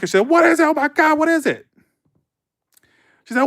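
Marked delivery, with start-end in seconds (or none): 0.3-1.7 high, loud imitated panic; panicking. Janet hearing the kid scream about a bug, panicking high and loud. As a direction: mimic the babysitter's frantic alarm. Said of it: "She's panicking".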